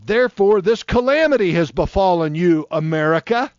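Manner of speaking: loud, ringing pulpit proclamation; scripture read as a loud warning from the pulpit; preaching scripture. Acted. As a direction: thunder it out like a prophet. Preaching scripture at full force.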